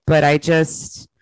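ordinary talk

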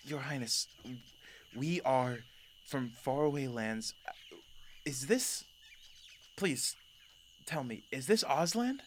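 lightly acted humble appeal to a king, rising on the question; petitioner to royalty. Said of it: "Greta actually stands up and says".

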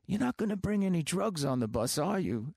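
put-on a half-hearted English Midlands accent, asking it like a protective dad; a rough imitation of an English Midlands accent. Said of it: "I can't do his accent that great".

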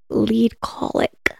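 "lead colic" very slowly, LEAD said "leed"; deliberate quote. Retold or imitated. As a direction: draw out "lead colic" as a dubious quoted term. Slow emphatic quote of defense claim.

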